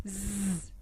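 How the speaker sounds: "Z." as a soft, quiet buzzing zzz; buzzing z sound. Shown as a demonstration. Teacher shows pupils the sound they hear, soft buzz. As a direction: softly buzz "Z." to model the sound. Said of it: "le son".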